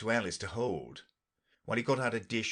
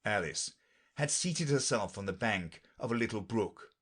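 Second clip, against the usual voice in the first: usual speaking voice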